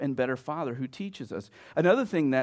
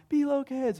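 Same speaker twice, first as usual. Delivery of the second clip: high-pitched call at ordinary volume; half-hearted imitation of a holler; mock yell; 12 semitones higher than usual. Dad retells calling the kids, mock yell. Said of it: "I yell out".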